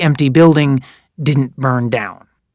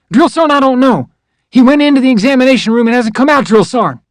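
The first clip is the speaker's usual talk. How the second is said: nervous recruit; 9 semitones higher, 4 dB louder than usual. Retold or imitated. Recruit answering yelling drill sergeant.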